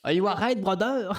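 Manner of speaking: mock his English lightly; Lewis le Fou's English. Teasing a buddy by imitating Lewis le Fou's English, lightly mocking. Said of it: "de l'anglais à Lewis le Fou".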